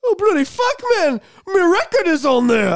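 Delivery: over-the-top mock English accent; bad English accent. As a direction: play a thrilled British rocker, badly faked English accent. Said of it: "I don't do a very good accent of English people".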